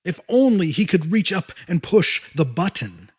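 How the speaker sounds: ordinary delivery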